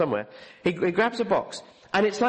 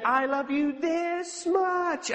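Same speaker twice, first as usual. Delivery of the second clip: slow, high, tender voice of Jesus; Jesus speaking tenderly; 9 semitones higher, much slower than usual. Retold or imitated. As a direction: stretch each word out lovingly. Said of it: "he said".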